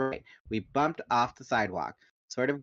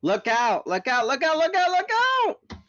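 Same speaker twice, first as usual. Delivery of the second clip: panicked yell, climbing higher and louder each time; 8 dB louder than usual. A narrator's reading. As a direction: scream the warning, rising in panic. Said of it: "Jake yelled".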